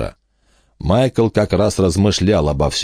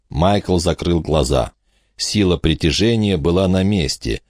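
ordinary delivery

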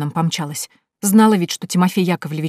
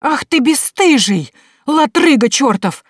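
high, loud furious scolding; angry scolding; 8 semitones higher, 6 dB louder, slower than usual. Acted. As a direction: scold him, shrill and slow. Akulina scolds drunk servant.

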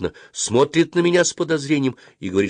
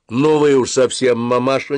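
louder, higher disapproving protest; displeased; 5 semitones higher, 5 dB louder than usual. A narrator's reading. Dentist reproving the mother of a crying boy, displeased. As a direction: scold with displeasure, voice lifted. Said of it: "недовольно сказала врачиха".

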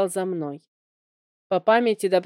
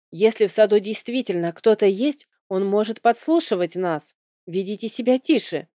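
ordinary delivery, nothing marked

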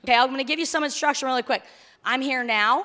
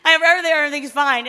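loud, higher, tumbling out fast; mock flustered insistence; 5 semitones higher, 10 dB louder, much faster than usual. Spouse insisting nothing is wrong, mock flustered insistence. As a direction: rush it out, hammed-up and flustered.

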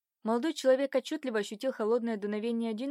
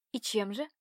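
ordinary delivery

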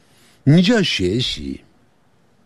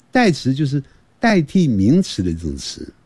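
usual speaking voice

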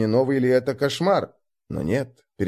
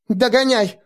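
calling out; 10 semitones higher, 4 dB louder than usual. Acted. Girl runs off calling.

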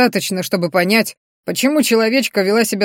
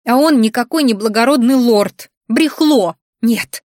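angry outburst; 3 semitones higher, 5 dB louder than usual. A narrator's reading. Heroine angry about the lord.

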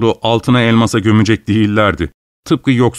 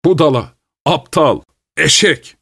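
higher, angry Budala, aptal, eşek; angry customer shouting; 5 semitones higher than usual. A narrator's reading. Furious customer insults cobbler Fyodor, higher angry shout. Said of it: "öfkeyle bağırıyordu".

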